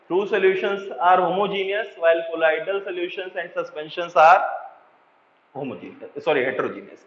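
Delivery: slow summary for writing down; slow dictation. Shown as a demonstration. Teacher dictating a summary sentence to the class, slowly. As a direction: lay out each word evenly.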